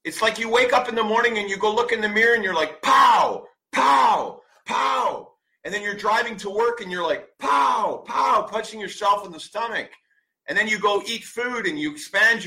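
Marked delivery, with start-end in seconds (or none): 2.8-5.3 mock punch sounds. Mimes punching yourself each morning. 7.4-8.5 mock punch sounds. Mimes punching yourself while driving.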